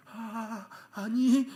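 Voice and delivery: high, rising mock-flustered denial; intimidated denial. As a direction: squeal a cowed little denial. (주눅 든 듯 높은 목소리로 부인)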